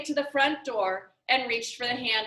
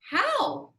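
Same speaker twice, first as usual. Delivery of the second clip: surprised question; 11 semitones higher than usual. Acted. Father asks in surprise.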